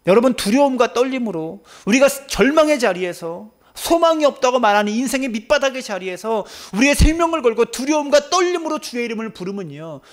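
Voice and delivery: high, loud pleading; fervent preaching. Real, not acted. Pastor exhorting the congregation, fervent. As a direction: press the congregation hard.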